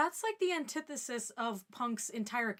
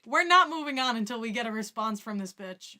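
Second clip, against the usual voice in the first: much louder, hammed-up fury; mock angry yell; 9 dB louder than usual. Calling out a viewer's comment, hammed-up angry yell. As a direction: blow up at the chat for show.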